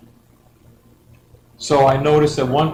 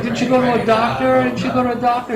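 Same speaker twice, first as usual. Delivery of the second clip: much higher, disbelieving squeak; incredulous; 12 semitones higher, 4 dB louder than usual. Retold or imitated. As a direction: blurt it out in total disbelief. Baffled by stranger's tumor request.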